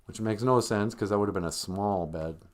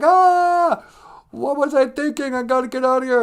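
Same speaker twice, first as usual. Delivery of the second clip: hammed-up high scream into panic; loud, high mock alarm; freaking out; 14 semitones higher, 7 dB louder than usual. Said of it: "she freaks out and she's like".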